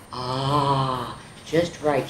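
low contented sigh. Acted. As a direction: sink in happily. Content in baby's bed.

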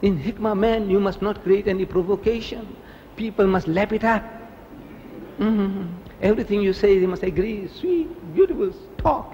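high, sing-song mock advice; mocking imitation of critics. Mimicking critics who demand agreeable talk.

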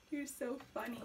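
soft whisper. Real, not acted. Owner whispering fondly at her funny bird. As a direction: breathe it as a whisper.

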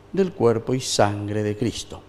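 ordinary talk, nothing marked

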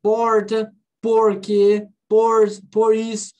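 paulista accent with curled-back R; strong paulista R, word by word. Shown as a demonstration. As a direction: say it with a São Paulo retroflex R. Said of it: "um paulista fala muito isso".

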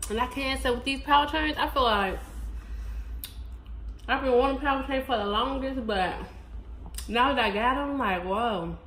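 talking while chewing chips; chewing between words; mouth full, chewing. Real, not acted. Seen 0: Chip put in mouth at -9; cheeks chew between words.

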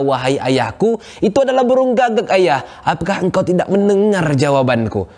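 son, fed up with his father's questions, answers angrily; angry. Acted. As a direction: lose patience with the father. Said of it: "akhirnya sudah marah dan berkata".

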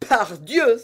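high, loud oath of glee; delighted exclamation. Acted. D'Artagnan to Constance, delighted by the irony, exclaiming. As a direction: bark it out with delight. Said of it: "s'écria D'Artagnan".

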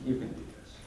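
softly voiced, nearly whispered reassurance; hushed encouragement. Retelling what people told him, hushed. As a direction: coax it gently under the breath. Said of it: "And people say".

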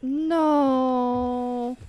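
long drawn-out wail of no; grieving no. Real, not acted. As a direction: draw the word out in disbelief. Reacting to a character's death.

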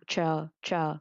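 "trờ" with the tongue curled up; heavy retroflex tr. Shown as a demonstration. Teacher shows standard Vietnamese tr, retroflex "trờ". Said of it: "Nó là trờ nặng".

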